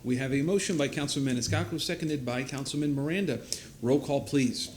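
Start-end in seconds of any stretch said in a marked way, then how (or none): none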